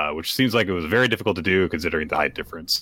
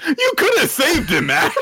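high, loud laughing protest; 6 dB louder, slower than usual. Real, not acted. Cohost laughing at Mash for not saving him. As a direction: laugh it out at the absurdity. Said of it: "laughing reaction".

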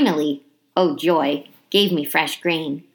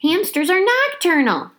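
high squeaky little voice; squeaky hamster; 11 semitones higher than usual. A narrator's reading. Hamster correcting the class, high and squeaky. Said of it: "I squeaked".

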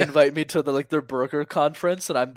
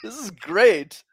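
mock delighted; 9 semitones higher, 7 dB louder, slower than usual. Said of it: "You're like".

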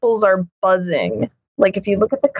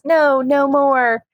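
weary plea; 6 semitones higher, slower than usual. Retold or imitated. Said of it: "And he even says".

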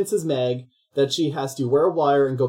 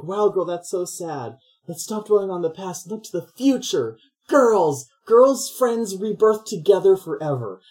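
put-on higher, slowed, sing-song chorus of gushing girlfriends; mock cheerful girlfriends; 5 semitones higher than usual. Friends cheering her up.

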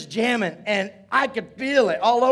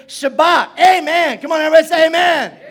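loud, high preacher's call; rallying church shout; triumphant shout; 6 semitones higher, 7 dB louder than usual. Shown as a demonstration. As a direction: shout it out to rouse the congregation. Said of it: "to shout out with a voice of triumph".

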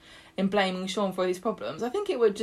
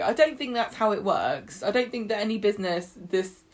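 ordinary talk

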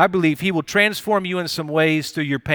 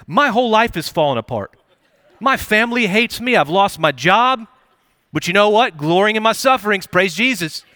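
mock joyful sufferer; 6 semitones higher, 4 dB louder than usual. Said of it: "people don't speak like this".

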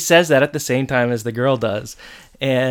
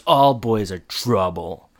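slow knowing voice; 3 semitones lower, slower than usual. Retold or imitated. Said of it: "And she goes".

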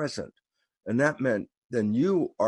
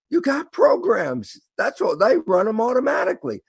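excited reply; 10 semitones higher, 4 dB louder than usual. Retold or imitated. Explaining programs to himself, worked up.

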